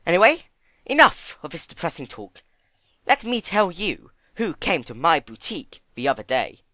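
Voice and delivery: brightened. A narrator's reading. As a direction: snap out of gloom into gossip. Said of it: "Rarity's solemn expression brightened".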